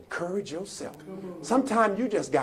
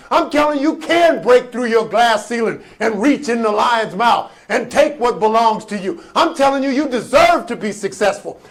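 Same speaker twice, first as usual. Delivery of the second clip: loud, raised, rhythmic declarations; full-force preaching; 6 semitones higher, 12 dB louder than usual. Acted. Preacher promises listeners they'll bounce back, shouting. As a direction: drive each promise home to the crowd.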